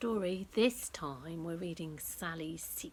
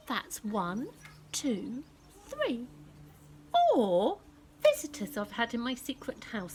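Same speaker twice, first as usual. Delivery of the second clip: excited counting; 4 semitones higher, 6 dB louder than usual. Acted. Sally counts visitors excitedly.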